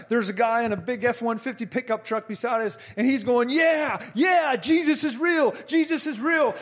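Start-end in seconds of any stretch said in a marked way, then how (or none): 3.5-6.6 high-pitched cheering affirmation; retold elated shouting, pitched up; imitated excited onlooker. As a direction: cheer it on like a fervent bystander. Onlooker cheering from pickup truck.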